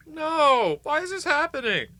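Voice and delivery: squeaky high hammed-up wail; mock despair. Vlogger hams up despair over coffee, squeaky high. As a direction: wail it high like a cartoon in crisis.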